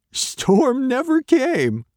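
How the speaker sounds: loud, high, slow dog report. A narrator's reading. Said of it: "Dog reported".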